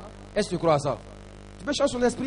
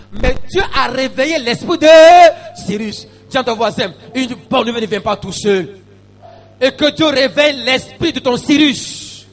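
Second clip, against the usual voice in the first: raised, high exhortation; fervent preaching; 5 semitones higher, 8 dB louder than usual. Real, not acted. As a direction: urge the congregation into prayer. Preaching and exhorting at full force.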